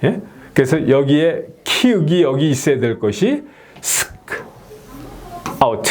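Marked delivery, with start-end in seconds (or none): 3.8-5.8 "스크 아웃" as sk, long pause, out; correct split pronunciation. Shown as a demonstration. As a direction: break scout after the k, "스크 ... 아웃". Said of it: "이렇게 돼야 되는데".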